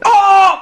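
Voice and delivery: loud, high "oh!" cry; startled yelp. Retold or imitated. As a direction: yelp out a shocked "oh!". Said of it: "like that".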